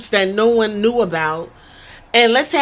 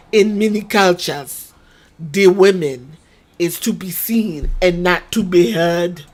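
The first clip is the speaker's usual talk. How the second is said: put-on Nigerian accent; 4 semitones lower than usual. Nigerian prince lecturing the Italians in a Nigerian accent. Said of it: "you got the African dude saying that".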